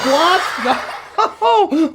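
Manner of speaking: high, giggling repeat of the pun; goofy delighted giggle; laughing. Acted. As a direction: laugh through the word.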